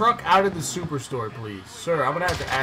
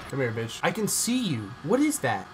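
ordinary talk

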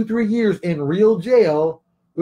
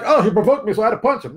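higher, louder mock whining excuse; mocking, raised-voice self-justification; 6 semitones higher, 4 dB louder than usual. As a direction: imitate someone making a lame excuse, pitched up. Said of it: "don't be like".

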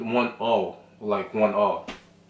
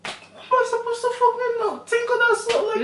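very high, fast squeal of disbelief; exasperated squeal; faster than usual. Real, not acted. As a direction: squeal it in frustration. Exasperated outburst at hard question.

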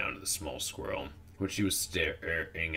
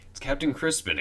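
high-pitched excited exclamation; exclaiming; 9 semitones higher, 4 dB louder than usual. A narrator's reading. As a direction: cry it out in a much higher voice. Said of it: "exclaimed Urchin".

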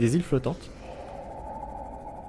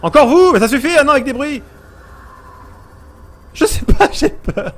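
high, loud flustered protest; scared frantic cries; panicked shouting; 9 semitones higher, 6 dB louder than usual. Real, not acted. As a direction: yell at the monsters in a panic. Panicking at monsters again.

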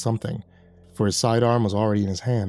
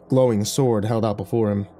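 usual speaking voice

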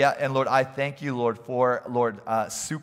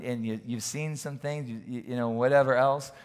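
usual speaking voice

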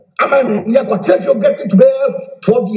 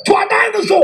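raised voice; 11 semitones higher than usual. Real, not acted. Heated rant about mother's house.

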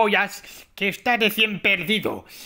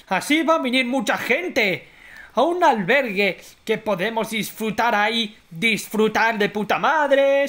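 ordinary talk

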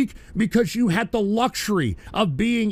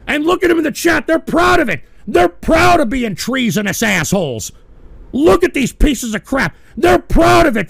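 higher, louder furious outrage; outraged shout; 5 semitones higher, 5 dB louder than usual. Real, not acted. Streamer raging at chatters proud of treason, higher and loud. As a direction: shout in disbelief at the traitors.

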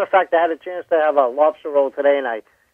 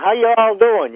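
higher-pitched mock Southern greeting; imitated Southern accent; 7 semitones higher, slower than usual. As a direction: put on a Southern cop's drawl. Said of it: "that's the stuff down south".

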